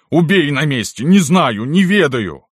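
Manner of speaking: high, loud protest of ignorance; desperate pleading. A narrator's reading. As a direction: swear it to the mistress for dear life. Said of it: "взмолился Никанор". (отчаянно взмолившись)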